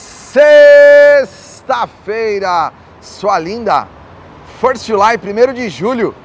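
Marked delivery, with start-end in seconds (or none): 0.0-2.7 hyped shout. Acted. Show opener shouted.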